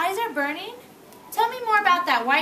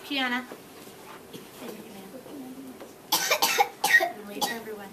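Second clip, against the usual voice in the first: soft, low instructions; don't break the calm; quiet; 5 semitones lower, 8 dB quieter, much faster than usual. Real, not acted.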